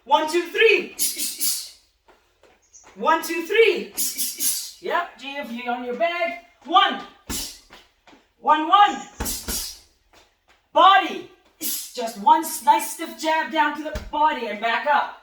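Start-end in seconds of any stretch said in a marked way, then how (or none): 0.0-3.9 loud, high punch call-outs; shouted counts. Shown as a demonstration. Coach calling punches to boxers, loud counts. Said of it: "ready, and let's go". 6.7-11.3 loud, high punch call-outs; shouted counts. Shown as a demonstration. Coach calling punches to boxers, loud counts. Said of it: "ready, and let's go".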